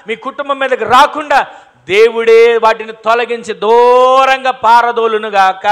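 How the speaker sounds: loud preacher's blessing building to the end; fervent prayer of blessing. Acted. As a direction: pour out the blessing with mounting fervour.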